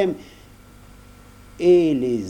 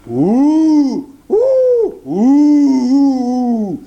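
very high, loud angry howls; wolves howling; 12 semitones higher, 9 dB louder, much slower than usual. A narrator's reading. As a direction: howl with pack fury. Angry wolves turn on him.

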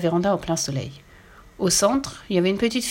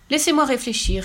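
put-on pondering girl; 6 semitones higher than usual. A narrator's reading. Girl voiced pondering a question.

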